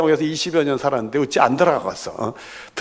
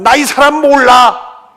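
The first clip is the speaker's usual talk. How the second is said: vehement sworn denial, high and loud; 베드로; 12 semitones higher, 11 dB louder, slower than usual. Acted. Peter swearing to his accusers, shouted denial. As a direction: shout it slowly like a curse-backed oath. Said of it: "저주하고 맹세하면".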